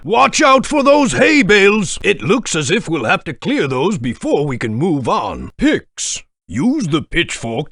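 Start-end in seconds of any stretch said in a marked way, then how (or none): 0.0-2.0 calling out a warning. Acted. Warning the player of hay bales.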